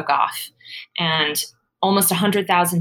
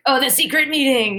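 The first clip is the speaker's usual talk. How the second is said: mock French accent, a little higher; playful bad French accent; terrible French accent; 6 semitones higher than usual. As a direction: put on a hammy French accent. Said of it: "I always say it with a French accent".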